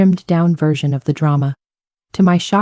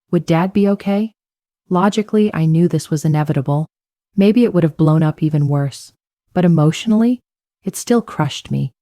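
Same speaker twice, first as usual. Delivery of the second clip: plain reading voice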